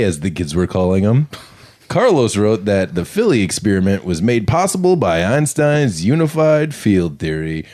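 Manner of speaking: podcast segment read; story narration. Acted. Reads segment over music.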